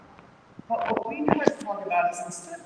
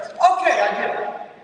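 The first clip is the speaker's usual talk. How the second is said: a higher, louder "Okay" dropping to a breathy "I get it"; grudging concession; 7 semitones higher, 7 dB louder than usual. Retold or imitated. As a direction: give in reluctantly, still annoyed. Angry man conceding grudgingly.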